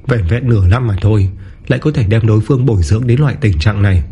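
plain reading voice

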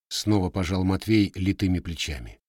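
usual speaking voice

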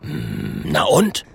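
low hum, swinging up to question; gruff bear growl. A narrator's reading. The bear looks at the little dragon, growling. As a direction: rumble deep, then query.

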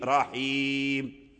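رحيم drawn out very slowly, ending on a bare m; pausal stop. Shown as a demonstration. Teacher demonstrating the pause on رحيم, slow drawn-out stop. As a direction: linger on رحيم and close without a vowel. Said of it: "فنقف بالسكون".

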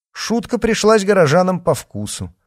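plain reading voice

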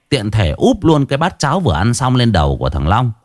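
ordinary delivery, nothing marked

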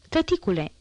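a daughter calling her father, no real shout. A narrator's reading. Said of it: "strigă o voce".

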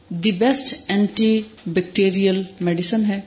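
usual speaking voice

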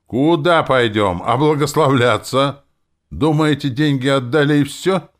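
high, loud outraged yell; bellowing. A narrator's reading. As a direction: yell after the departing couple. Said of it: "Зодчий заорал нам в спины". (орёт вслед, возмущённо и высоко)

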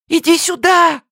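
very high, loud pleading; frightened plea. A narrator's reading. Li begs Abe to come to her. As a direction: beg him to come back.

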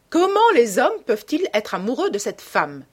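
animated, swooping outcry; indignant exclamation. A narrator's reading. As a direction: exclaim the question, punching the start. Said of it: "s'écria la vieille dame".

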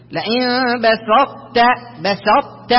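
slow "لئن بسطت", heavy ط held silent, ت sounded; only ت released. Shown as a demonstration. Teacher explains partial assimilation, ط quality kept, ت pronounced. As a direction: keep ط heavy in بسطت but release just ت. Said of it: "وإنما ينطق الحرف الذي بعده".

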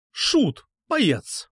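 high-pitched exasperated jab; scornful insult. A narrator's reading. Girl calling her suitor a clown, scornful. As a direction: throw each insult separately, pitched high.

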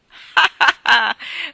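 a big loud father's laugh; loud hearty laugh. A narrator's reading. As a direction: burst out laughing loudly. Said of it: "disse mister Tulliver ridendo". (risata forte e cordiale del padre)

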